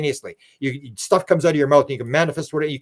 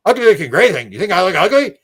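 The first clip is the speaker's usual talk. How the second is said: louder, higher, indignant echo of the insult; mock offended; 5 semitones higher, 7 dB louder than usual. The alien, told he looks ugly, mock offended and louder. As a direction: play the hurt alien.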